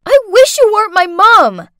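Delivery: loud, high-pitched furious outburst; angry scream. A narrator's reading. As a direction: scream it at your mother in anger. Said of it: "Through angry sobs, I screamed at her".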